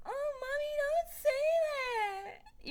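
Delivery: put-on very high, slow whiny kid; little boy voice. Son protests to his mother in a very high whine. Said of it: "her son was like".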